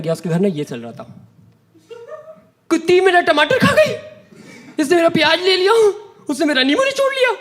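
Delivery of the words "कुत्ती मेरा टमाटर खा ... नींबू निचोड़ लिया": high, shrill-pitched mock girly bickering; mock girls squabbling. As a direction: play the roommates fighting over vegetables, pitched up. Girls fighting over groceries, mocked. (लड़कियों की नकली तीखी ऊँची आवाज़ में झगड़ा)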